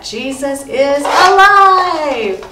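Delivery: stretched, high, loud joyful shout; excited cheer. Shown as a demonstration. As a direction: cheer it big like the toy jumping up. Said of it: "but you can go".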